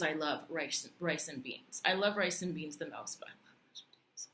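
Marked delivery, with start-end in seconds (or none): 3.2-4.3 whispered confession about mashed potatoes; a guilty little whispered admission; whispered aside. Acted. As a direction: drop to a secret whisper.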